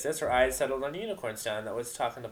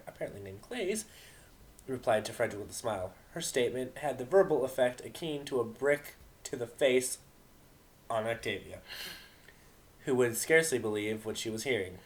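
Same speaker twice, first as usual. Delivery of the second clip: ordinary delivery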